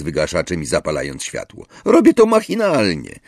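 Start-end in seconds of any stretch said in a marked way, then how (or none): 1.8-3.2 Ron, defensive. A narrator's reading. Ron voiced in dialogue.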